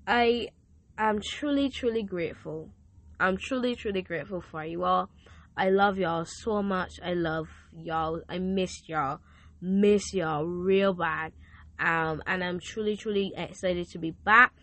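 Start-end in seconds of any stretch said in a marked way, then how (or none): none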